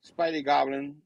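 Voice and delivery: ordinary talk, nothing marked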